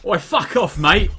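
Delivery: loud chav accent, hammed-up; brash London chav accent; rough London slang. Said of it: "a very sort of chav accent".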